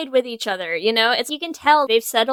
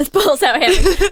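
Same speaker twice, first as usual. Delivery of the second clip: laughing; 5 semitones higher, 5 dB louder than usual. Real, not acted. Echoing a friend's joke, laughing. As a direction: repeat it through a laugh.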